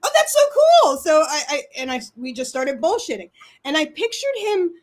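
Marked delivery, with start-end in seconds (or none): 0.0-1.0 high-pitched astonished delight; retold amazement. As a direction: relive being amazed by something cool. Retells own amazed first reaction.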